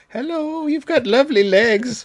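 high, slow crooning flattery; mock sweet talk. Host sweet-talking the banana spider, high and slow crooning. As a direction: woo the spider in a high coo.

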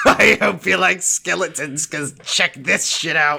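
lightly voiced pirate captain; a hint of the pirate; Barbossa voice. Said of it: "Barbossa was like".

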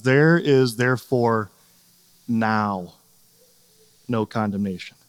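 NOW set apart by long pauses, low and soft; pause, drop gently onto NOW, pause before the rest; highlighting NOW. Shown as a demonstration. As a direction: isolate NOW in a hushed, low delivery. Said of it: "to highlight is the one simple word".

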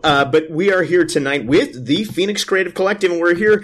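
radio voice. Acted. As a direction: play the big-voiced host. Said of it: "Continue the introduction".

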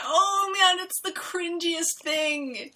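high, drawn-out groan of secondhand embarrassment; real drawn-out cringe; cringing. Real, not acted. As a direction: stretch it out high, squirming with cringe. Groaning over a cringy scene.

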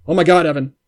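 loud, fast, excited future self. Acted. Future self bursting in to warn his past self, loud and fast. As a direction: blurt it all out to your past self, loud.

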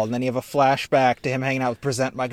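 ordinary talk

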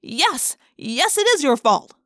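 loud angry accusation; yell. A narrator's reading. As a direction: yell the blame at him. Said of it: "I wanted to yell".

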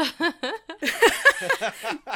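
high, loud, real laugh; burst of laughter. Real, not acted. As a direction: crack up at the awkward moment. GM laughs at awkward flirting.

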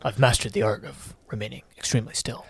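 flat literal alien warrior; Drax impression. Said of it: "let's get some Drax lines".

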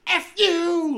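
very high, loud screamed curse into a phone; imitating a furious phone scream; screaming swear. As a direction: scream it down the phone at full force. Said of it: "just, like, super screaming".

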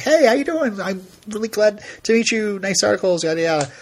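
high, bright mock-friendly hello; polite fanboy greeting. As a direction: play his eager self greeting the writer. Retold greeting to a journalist.

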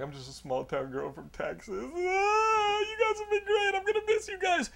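high, put-on sobbing voice; hammed-up tearful goodbye; mock crying. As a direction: whimper it out like a sad waitress. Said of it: "now she's just crying".

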